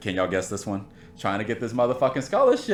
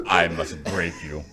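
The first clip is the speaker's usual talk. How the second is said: villain quote; 5 semitones lower, slower than usual. Retold or imitated. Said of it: "movie villain line".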